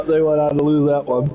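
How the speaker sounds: ordinary talk, nothing marked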